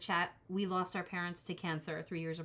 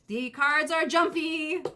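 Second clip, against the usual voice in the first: excited; 8 semitones higher, 9 dB louder, slower than usual. Real, not acted. Shuffling lively cards.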